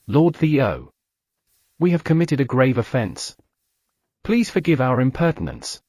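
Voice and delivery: usual speaking voice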